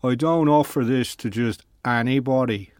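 lightly voiced Irish rock star; a hint of his put-on accent. Rock star offering powder, lightly put-on voice. [name] voice in anecdote.

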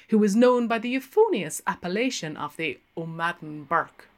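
loud, high pompous oration; grandiloquent. Acted. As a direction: orate it grandly. Lenehan's grandiloquent speech.

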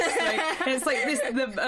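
ordinary talk, nothing marked

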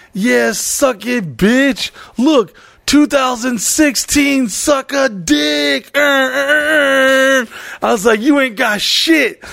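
very high, slow triumphant yelling; gloating joy. Retold or imitated. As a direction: crow it out like a winner. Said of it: "I start screaming with fucking joy".

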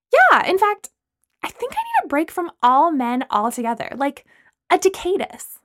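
chirpy and curious; Lana. Acted. Lana asking Brooklyn about the drink.

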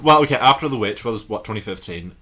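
ordinary talk, nothing marked

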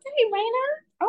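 a high, impressed okay. Retold or imitated. Reacting to Raina's fresh face.

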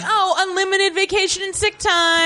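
high, breezy startup pitch; mock startup voice. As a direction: play a chirpy tech recruiter. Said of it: "They're like".

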